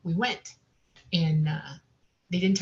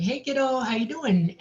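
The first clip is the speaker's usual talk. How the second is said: friendly craft greeting; 6 semitones higher than usual. Retold or imitated. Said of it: "he's like".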